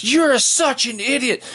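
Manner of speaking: high mock woman's voice spitting an insult; shrill woman insult. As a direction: squeal it like the furious driver. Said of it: "And she says".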